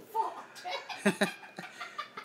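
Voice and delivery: a high airy giggle bursting out; high breathy giggle. Real, not acted. Laughing at the idea of a Half-Life Part Three, high breathy giggle. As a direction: let a high unvoiced laugh escape.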